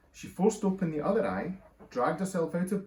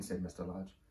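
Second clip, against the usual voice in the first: ordinary delivery, nothing marked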